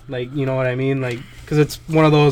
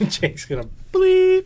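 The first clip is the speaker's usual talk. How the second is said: laughing; slower than usual. Real, not acted. Laughing tease about editing.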